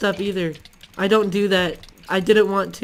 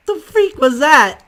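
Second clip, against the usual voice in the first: a much higher, louder, slowed cry of disbelief; shocked disbelief; 7 semitones higher, 6 dB louder than usual. Retold or imitated. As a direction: react as if an arm just reached into your stall. Hairy arm takes toilet paper.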